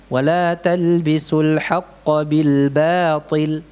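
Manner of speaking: Wala talbisul haqqa bil batil recited slowly, thin ba; slow recitation. Shown as a demonstration. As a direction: recite the verse slowly, light ba. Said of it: "Ba tetap tipis".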